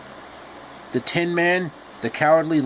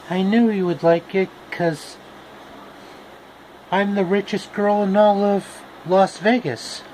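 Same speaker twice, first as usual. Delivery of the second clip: Dorothy voice; 5 semitones higher than usual. Acted. Dorothy boasting in voice.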